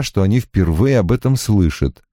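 plain reading voice

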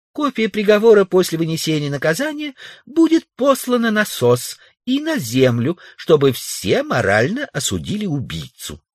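very high female character voice; Тулия. A narrator's reading. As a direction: declare it in a high-pitched woman's voice. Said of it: "ответила Тулия из-за двери". (заявляет высоким женским голосом)

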